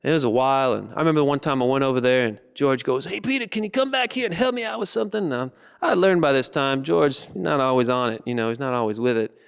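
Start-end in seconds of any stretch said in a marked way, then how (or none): 3.1-5.2 high character voice; calling for help. Acted. George calls Peter back to help him out, high calling voice. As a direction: call him over, pitched up.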